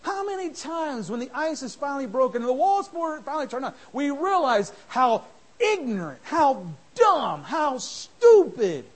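loud, driving sermon voice; fiery preaching. Acted. Preacher shouting down small grudges. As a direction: hammer the words out from the pulpit.

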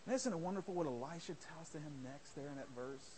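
ordinary delivery

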